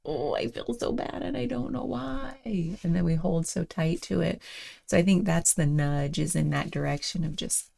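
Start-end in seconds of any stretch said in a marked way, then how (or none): 0.1-2.4 high and much softer, a whiny mock complaint; lightly hammed-up moping; mock whining. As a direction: sulk as a self-pitying inner voice. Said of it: "And then that can be like".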